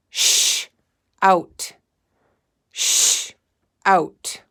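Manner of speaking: "Sh-" then "out" split apart; segmented sounds. Shown as a demonstration. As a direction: say "Sh-" and "out" as separate chunks. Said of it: "Say it with me".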